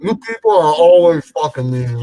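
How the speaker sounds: talking through food; words round a mouthful of dinner; mouth full. Real, not acted. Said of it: "don't talk with your mouth full".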